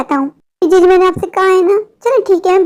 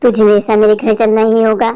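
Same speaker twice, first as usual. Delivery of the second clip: much lower witch voice giving orders; commanding witch; 9 semitones lower than usual. Acted. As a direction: order him like a monstrous witch. Witch commands him home. (बहुत नीची आवाज़ में हुक्म देती चुड़ैल)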